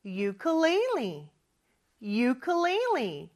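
"Ukulele" slowly, starting with "you", English stress; model word for repeating. Shown as a demonstration. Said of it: "같이 한번 따라해 볼까요?".

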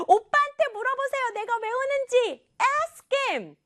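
put-on very high, wailing child voice; hammed-up tearful tattling; upset child. Jiyul telling mom to ask brother, high-pitched wail. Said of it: "지율이의 마지막 한마디".

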